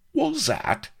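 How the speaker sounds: sudden high squeak of surprise; startled. A narrator's reading. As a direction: jump out of a reverie. Vladimir startled.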